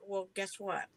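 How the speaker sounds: ordinary delivery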